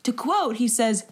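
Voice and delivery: ordinary delivery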